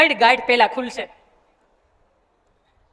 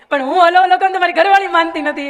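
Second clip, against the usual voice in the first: put-on higher, louder old man complaining; grumbling old man; 5 semitones higher, 5 dB louder than usual. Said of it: "ઈ કે". (પત્ની વિશે ફરિયાદ કરતા દાદા, ઊંચા અને મોટા અવાજે)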